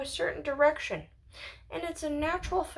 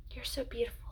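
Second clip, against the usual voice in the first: whispered; 4 semitones lower, 9 dB quieter, faster than usual. Retold or imitated. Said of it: "he smells it and he goes".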